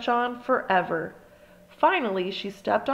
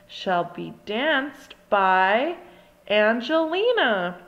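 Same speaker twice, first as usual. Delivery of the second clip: very slow build, then the name Angelina a bit louder; dramatic reveal; 3 dB louder, much slower than usual. A narrator's reading. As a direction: drag out the pause before Angelina. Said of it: "Miss Lily told them".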